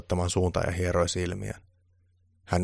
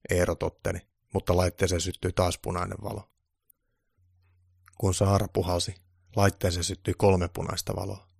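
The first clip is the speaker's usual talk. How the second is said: usual speaking voice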